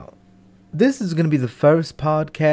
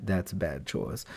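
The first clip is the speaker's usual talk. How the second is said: ordinary delivery, nothing marked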